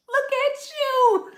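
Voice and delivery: high, far-off mocking coo; teasing taunt. To the angry ex, a high mocking coo. Teasing the person who's mad.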